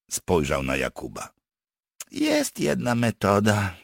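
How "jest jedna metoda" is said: slow, higher reluctant admission; sighing. Acted. Partisan reluctantly offers the captives a method, sighing. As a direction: let it out slowly, like a sigh. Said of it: "westchnął Wędrowicz".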